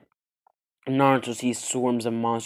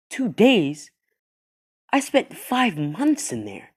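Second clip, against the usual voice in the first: higher, louder stunned exclamation; can't believe only two days passed; high incredulous outburst; shocked disbelief; 9 semitones higher, 6 dB louder, slower than usual. Acted. Naruto shocked at the time.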